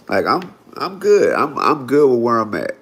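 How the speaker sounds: ordinary talk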